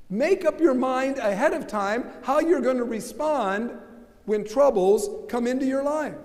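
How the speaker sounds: usual speaking voice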